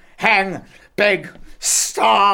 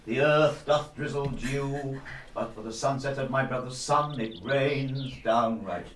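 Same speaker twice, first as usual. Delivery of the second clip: tender comforting; 6 semitones lower, 4 dB quieter than usual. Acted. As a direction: gently soothe a crying girl. Father comforting weeping daughter.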